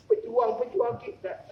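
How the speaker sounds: ordinary delivery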